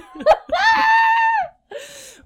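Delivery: high, loud squeal of laughter; helpless high-pitched cackle; shrieking laugh. Real, not acted. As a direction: crack up with a long shriek. Cracks up at the comparison.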